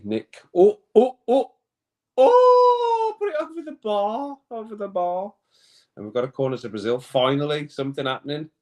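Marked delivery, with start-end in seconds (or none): 0.5-5.3 much higher, louder cries at a near miss; real match excitement fading to groan; excited shout. Real, not acted. Watching the match, shouting at a shot over the bar.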